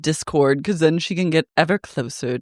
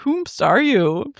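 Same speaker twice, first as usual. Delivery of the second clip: slow, high, haughty put-on voice; drawn-out mock-grand question; mock posh snoot; 5 semitones higher, slower than usual. Friend mocking a stranger on the show, haughty mock voice. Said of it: "I was like".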